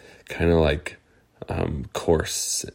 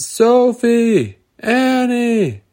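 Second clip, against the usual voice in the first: high, far-carrying call; yelling out; 5 dB louder, much slower than usual. Acted. Girls yell for lost friends, high calls. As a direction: call their names into the cave. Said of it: "they were yelling out".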